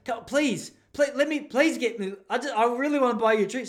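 high, loud, fast pleading; begging. Retold or imitated. Pleading with a girl at the club to take a drink, high and rushed. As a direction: beg her desperately, rushing.

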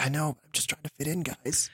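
put-on whispered American accent. Fake mobster confiding to friends, hushed in American accent. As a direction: confide quickly under your breath in plain American. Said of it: "whisper over to them, like, in, your, in, like, a complete American accent".

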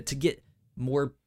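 ordinary talk

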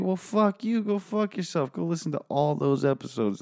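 a jokey high-pitched "fuck you" to the listener; mock outrage. Podcaster mock-snapping at listeners who won't re-listen, hammed-up indignation.

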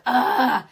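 loud, drawn-out imitation groan; exaggerated loud "ugh" of annoyance; mock exasperated groan. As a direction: groan like a fed-up son.

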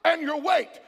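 rousing, driving sermon delivery; full-force preaching. Real, not acted. Preacher urging the church to praise God, full-force preaching. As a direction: preach it out to a congregation.

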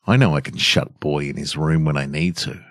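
plain reading voice

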